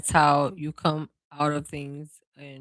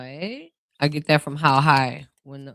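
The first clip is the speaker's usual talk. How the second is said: usual speaking voice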